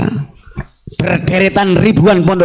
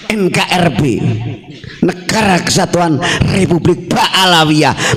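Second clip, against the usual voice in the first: normal talking voice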